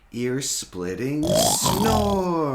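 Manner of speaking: loud, slow and drawn out; booming snore build-up. A narrator's reading. Dad reading to kids, hams up the snore. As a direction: stretch the word snore big and loud.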